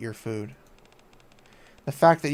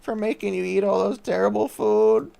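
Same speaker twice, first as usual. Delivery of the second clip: put-on high, slow, tearful girl's apology; tearful Crust; 9 semitones higher, slower than usual. Crust apologizes to the commander, high and tearful. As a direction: voice the little chef choking up with guilt.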